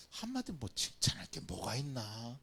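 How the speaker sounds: soft, unvoiced musing; 속삭이는 혼잣말; whispered thought. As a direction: whisper it as if thinking to yourself. Voicing someone's inner thought.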